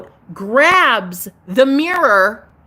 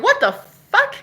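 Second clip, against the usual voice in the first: shocked; 12 semitones higher, slower than usual. Retold or imitated. Retelling seeing the portal.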